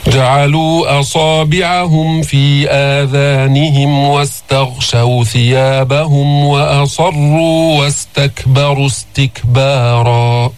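slow, measured tajweed recitation; steady melodic Quranic chant. Shown as a demonstration. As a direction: chant the verse with long, held vowels.

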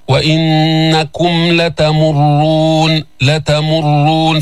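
slow لتمرون with a heavy, doubled r; راء مفخمة مشددة. Shown as a demonstration. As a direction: press the r in لتمرون. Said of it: "فخم الراء وجيب الشد".

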